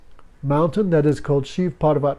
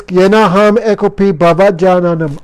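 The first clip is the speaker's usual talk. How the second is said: verse recitation; 2 semitones higher, 6 dB louder than usual. Shown as a demonstration. Reciting the same verse again.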